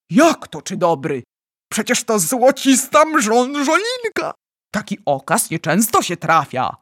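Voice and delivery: loud, marvelling protest; excited insistence. Acted. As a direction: insist loudly on how wonderful it is. Giant protests that dream is superb.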